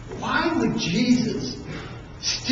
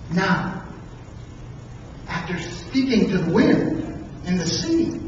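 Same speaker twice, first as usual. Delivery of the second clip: ordinary delivery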